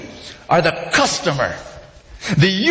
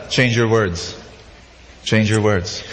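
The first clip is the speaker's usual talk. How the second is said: lower, calm counsel to a seeker; calm advice; 6 semitones lower than usual. Retold or imitated. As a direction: answer gently and firmly. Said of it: "I tell them".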